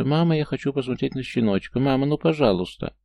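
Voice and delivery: put-on high, pleading child's voice; pleading little girl. Daughter begging her mother to see the puppy, high pleading child voice. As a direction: beg like a small girl whose voice cracks. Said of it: "голос девочки сорвался".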